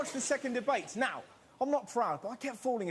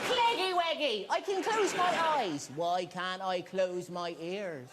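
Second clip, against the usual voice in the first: put-on child voice; 3 semitones lower than usual. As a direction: ask it like a little child. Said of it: "Imagine the leaders answering their questions".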